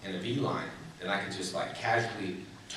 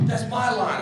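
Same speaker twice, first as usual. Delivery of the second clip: co-star grabbing his line; 12 semitones higher, 4 dB louder, slower than usual. Retold or imitated. Co-star snapping over a line.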